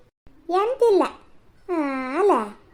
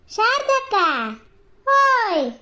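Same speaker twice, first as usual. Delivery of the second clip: calling out; 5 semitones higher, much slower than usual. Acted. Calling out to a neighbour.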